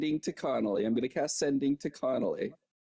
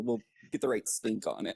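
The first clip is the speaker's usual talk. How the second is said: usual speaking voice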